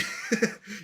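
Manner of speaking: brief breathy, voiceless chuckle; short laugh. Real, not acted. Amused at own wish for money, short chuckle. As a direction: chuckle at your own line.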